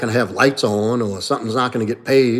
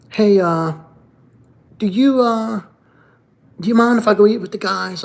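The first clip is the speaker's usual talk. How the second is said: much higher, timid asking voice; mocking meek husband; 9 semitones higher than usual. Mocking a man phoning his wife to join the guys, much higher and meek. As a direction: squeak it like a man begging permission. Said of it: "you have to call and say".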